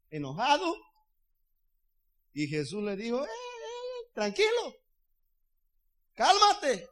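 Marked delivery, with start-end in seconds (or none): 3.2-6.9 high, crying-out mock call to the waves; Jesus calming the sea. Jesus telling the angry sea to calm down, high and theatrical. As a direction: hush the storm in a high theatrical voice.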